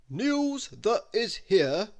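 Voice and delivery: a mock newsreader announcing; newscaster announcing. A narrator's reading. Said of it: "shouted the newscasters".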